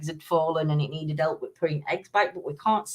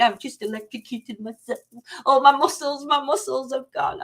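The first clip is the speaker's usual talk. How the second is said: mock-dramatic moaning, pitched higher on the muscles; hammed-up husband; 6 semitones higher than usual. Retelling her husband's shock in a hammed-up voice. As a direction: overplay his shock for laughs. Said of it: "He went".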